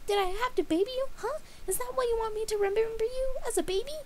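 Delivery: high, loud pouty rant; ranting Pinkie. A narrator's reading. Pinkie berating Dash for being inconsiderate, high and loud. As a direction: tear into her, hurt and offended.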